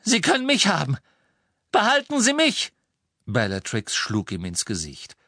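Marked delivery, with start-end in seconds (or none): 0.0-2.7 high, loud desperate plea; frantic pleading shout; calling out. A narrator's reading. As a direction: shout the offer, climbing higher. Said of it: "rief Ron".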